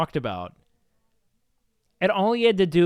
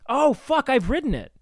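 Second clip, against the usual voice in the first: higher, louder burst of sudden recognition; startled realization; 9 semitones higher, 5 dB louder than usual. Real, not acted. Speaker realizing they have ridden the L A subway, startled. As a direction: blurt it out as the memory hits.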